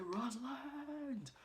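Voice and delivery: a long, high cry of her name; drawn-out call. Retold or imitated. Husband crying out to his wife in danger: drawn-out high call. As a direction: call out her name across the distance, stretched slow.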